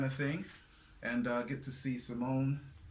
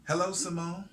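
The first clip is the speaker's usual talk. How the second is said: bright host greeting; 9 semitones higher, 7 dB louder than usual. Acted.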